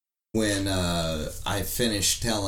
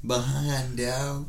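higher-pitched teasing echo; mock repeat in a higher voice; 6 semitones higher, slower than usual. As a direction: parrot it back, pitched up. Echoes co-host's words, teasing.

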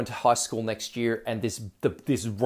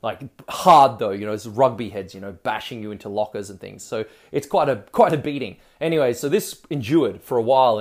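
usual speaking voice

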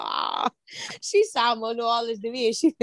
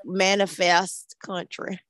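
put-on slow, drawn-out Texas country accent. Co-host announcing she is going country, Texas accent. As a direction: stretch it into a playful Texas drawl. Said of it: "flexing my Texas accent".

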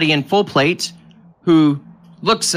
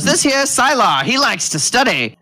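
higher, folksy little-man voice; little bald man; 7 semitones higher than usual. Acted. Tiny man introducing his armored friend, higher character voice. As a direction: show off your big companion. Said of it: "He goes".

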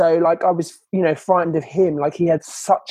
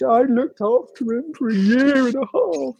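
higher, slowed mimicry of the ex's complaint; a hint of the ex boasting, mocked and higher; mock whiny ex; 8 semitones higher than usual. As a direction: parody his self-pity, drawn out. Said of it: "he was".

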